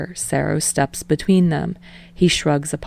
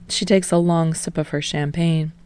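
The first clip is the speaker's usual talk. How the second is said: ordinary delivery, nothing marked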